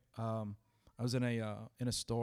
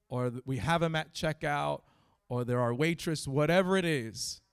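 raised, louder preacher's voice; higher and forceful, driving the message home; impassioned preaching; 4 semitones higher, 6 dB louder than usual. Real, not acted. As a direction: preach it with urgency, punching each phrase.

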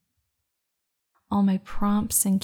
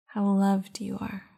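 guided meditation. Acted. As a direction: guide them into calm, unhurried. Leads a meditation.

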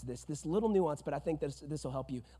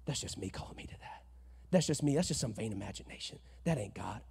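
softer, lower dismissive self-talk; inner voice; 5 semitones lower, 6 dB quieter than usual. Retold or imitated. Listener brushing off God's voice, quiet mutter. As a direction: mutter it to yourself. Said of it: "you just thought".

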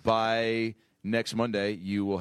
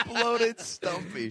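high-pitched laughing repeat; much slower than usual. Real, not acted. Repeating a friend's insult through laughter, laughing. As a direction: crack up while getting the words out.

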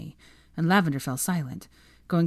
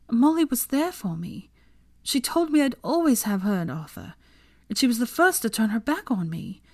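higher, mildly tearful pleading; feigned upset, pitched up; pretend crying; 6 semitones higher than usual. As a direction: put on a wounded voice to win sympathy. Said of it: "did you just pretend to cry".

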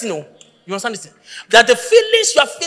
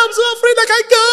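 mock fearful; 13 semitones higher, 5 dB louder, faster than usual. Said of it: "I said".

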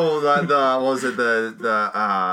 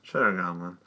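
low, quiet "Cheddar Goblin", stated rather than asked; corrected imitation; 9 semitones lower, 7 dB quieter than usual. Correcting a friend's impression of the hero, low and quiet. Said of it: "it's more like".

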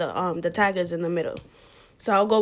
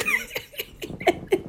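laugh. Real, not acted. Laughs at own cancer line.

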